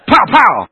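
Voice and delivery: loud, high "pow, pow"; emphatic slap noises; imitated back slaps. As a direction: punch out two smacking sound effects. Imitates hard pats on the back.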